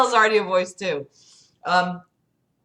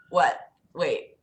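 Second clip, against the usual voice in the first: plain reading voice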